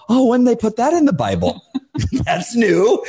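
high, laughing fake astonishment; mock surprise. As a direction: act amazed at a familiar verse, laughing. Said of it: "And I go".

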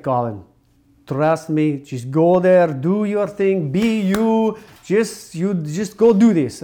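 imitated Italian agent, pitch climbing as she urges; thick Italian accent. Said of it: "she has this really thick Italian accent".

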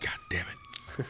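breathless whispered aside; a soft whispered God damn it; whispered curse. Real, not acted. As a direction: mutter it under your breath. Friend reacts under breath.

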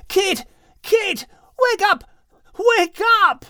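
high urgent calls to wake her; shouting. A narrator's reading. Dog Max shouting to wake sleeping Kate. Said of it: "Max continued shouting to her".

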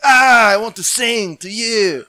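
high, loud, slow crooning. Pretend. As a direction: ham it up like a lounge singer.

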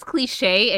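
CLICHE with the final E sounded, "klee-shay"; pronounced klee-shay. Shown as a demonstration. Co-host correcting the joke, klee-shay with accented E. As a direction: say CLICHE in two syllables, ending in "shay". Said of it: "There is an accent on that E".

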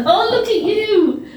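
high, slow gushing hello; delighted greeting. Real, not acted. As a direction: coo over them with delight. Greeting someone warmly.

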